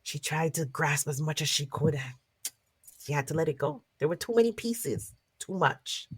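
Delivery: lively storyteller narration; story narration. Acted. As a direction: tell it to a circle of listeners. Storyteller narrates folk tale.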